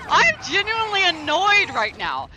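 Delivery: loud, high laugh running into the words; exasperated laugh. Real, not acted. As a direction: laugh in disbelief while complaining. Exasperated laughter after outburst.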